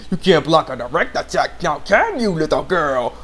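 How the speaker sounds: put-on taunting villain, pitch swooping up midway; mock villain taunt. Satan taunting the girl he is grabbing, hammed-up sneer.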